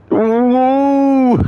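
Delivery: very high loud yelp; startled ooh. Real, not acted. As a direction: squeal as the ground drops away. Gaming stream, sudden fall.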